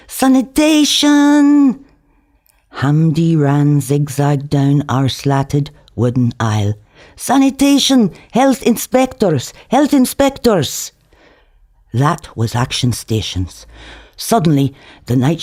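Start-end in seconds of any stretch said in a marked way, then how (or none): 0.1-1.8 high, slow drawn-out alarm cry; stretched, panicked yell; alarmed shout. A narrator's reading. Hamdi raises the alarm. 7.2-10.9 high urgent warning calls; repeated panicked alert; alarmed cries. A narrator's reading. Hamdi runs warning everyone.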